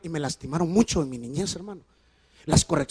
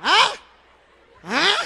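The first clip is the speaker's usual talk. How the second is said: ¿ah? incrédulo; 9 semitones higher than usual. Real, not acted. Pregunta retórica al público.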